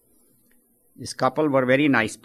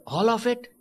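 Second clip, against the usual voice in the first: amazed question; 10 semitones higher than usual. Acted. Amazed newcomer asks St. Peter.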